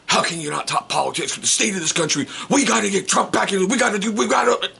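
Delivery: mock political rant. Stranger ranting about politics.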